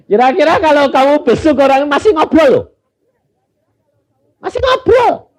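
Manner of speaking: preaching at full force. Acted. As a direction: cry it out to the congregation in disbelief. Preacher exclaiming to congregation. (seruan khotbah dengan suara tinggi)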